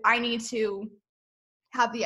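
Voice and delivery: ordinary talk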